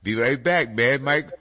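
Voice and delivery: usual speaking voice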